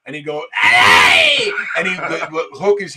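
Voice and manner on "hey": loud, drawn-out mock yell; imitated scream. Imitating Frenchie falling onto the baggage belt, loud mock yell. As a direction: holler it like the tumbling prankster. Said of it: "he was going around screaming at people".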